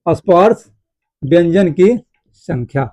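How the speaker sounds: slow, broken into pieces with long pauses; slow dictation. Shown as a demonstration. Teacher dictating key points to students, slow and broken up. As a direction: dictate piece by piece for note-taking.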